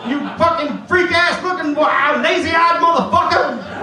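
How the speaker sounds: loud, high, shouted foul-mouthed puppet; Eddie Murphy-style dummy. Retold or imitated. Doll curses the boy in front of class, high and shouting. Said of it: "Lester was like".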